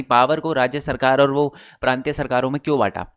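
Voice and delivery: usual speaking voice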